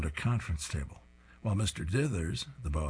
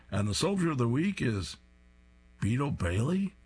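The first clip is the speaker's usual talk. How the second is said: surprised announcement; 5 semitones higher than usual. Acted.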